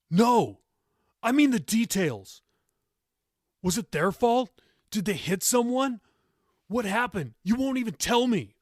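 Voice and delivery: loud, high, heated questioning; angry demand. A narrator's reading. Grandson demanding details of parents' death, shouting. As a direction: press her hard, shouting.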